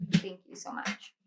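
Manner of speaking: soft whispered thanks off to the side; quick hushed thank-you away from the mic; aside whisper. Real, not acted. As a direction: whisper it to someone in the room. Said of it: "I was thanking the room".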